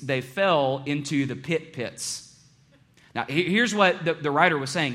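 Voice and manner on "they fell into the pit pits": "pit pits" said slowly, with weight on both words; a slow, teaching emphasis on "pit pits"; slow emphatic reading. Shown as a demonstration. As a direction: read the doubled "pit pits" deliberately. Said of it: "the Hebrew literally reads".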